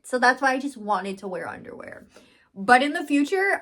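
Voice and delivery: normal talking voice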